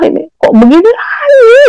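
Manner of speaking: high, tearful cry of despair; sobbing shout at the accusation. Retold or imitated. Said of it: "Saya nangis".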